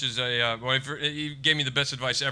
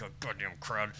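low, quiet grumble; grumbling; 5 semitones lower, 8 dB quieter than usual. Retold or imitated. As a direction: sulk it under your breath. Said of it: "And I'm like".

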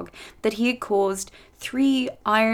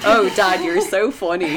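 laughing mock reply; 5 dB louder than usual. Princess mocks king in story.

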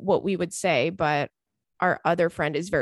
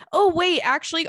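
sudden realization; 9 semitones higher, 4 dB louder than usual. Real, not acted. Sudden change of mind.

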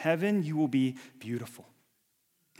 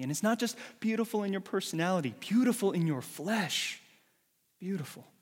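higher, faster, louder preaching; rising fervour; 6 semitones higher, 4 dB louder than usual. Acted. Preacher fervently insisting to the congregation. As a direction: push it up with fire.

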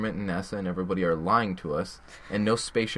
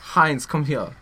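mock German accent, slightly raised; Hitler; 3 semitones higher, slower than usual. Dictator joking with his bros, mock German accent. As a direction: call a pal over in fake German accent. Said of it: "He was like".

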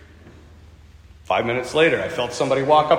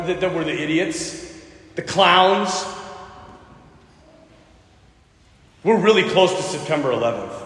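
earnest preaching; 3 semitones lower than usual. Acted. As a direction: preach it from the heart. Preaching the lesson.

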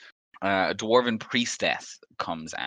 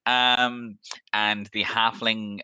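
ordinary delivery

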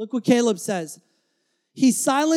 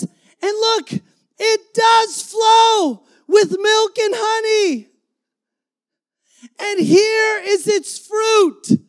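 high, loud, slow cry of joy; joyful shout; 10 semitones higher, 9 dB louder, slower than usual. Retold or imitated. Spies show the fruit to the crowd, jubilant slow shout. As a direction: proclaim it like thrilled spies.

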